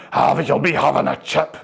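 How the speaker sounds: put-on aggressive Glasgow drunk. Drunk insisting the passer-by take a chip, pushy Glasgow accent. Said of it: "more and more aggressive".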